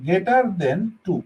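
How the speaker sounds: words drawn out one by one; slow careful reading. Shown as a demonstration. Teacher reading the sign aloud slowly for students. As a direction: read it slowly for them. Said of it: "উচ্চারণ করতে হবে three greater than".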